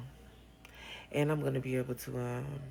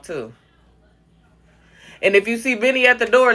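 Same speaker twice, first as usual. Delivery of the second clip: loud calls of instructions; calling out; 4 semitones higher, 13 dB louder than usual. Real, not acted. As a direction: call orders across the house.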